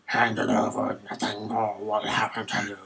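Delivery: Batman. Acted. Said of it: "he sounds like Batman".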